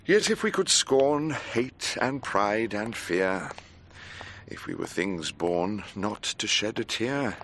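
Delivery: slow, soft reading of a poem, fading to a whisper; poem recitation. Acted. Recites Shelley poem over music.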